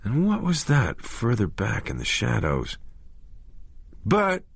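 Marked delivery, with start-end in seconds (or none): 4.1-4.4 high, piercing scream of his name; frantic shriek. A narrator's reading. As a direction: yell his name from across the road. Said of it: "Vicky screamed at him".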